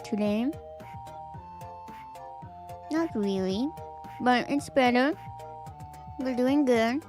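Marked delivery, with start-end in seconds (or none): none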